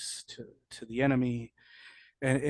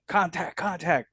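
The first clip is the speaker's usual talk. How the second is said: slow, higher drawn-out radio call; called out contact; 6 semitones higher, 3 dB louder, slower than usual. Retold or imitated. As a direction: sound off to the whole unit. Calling contact over radio.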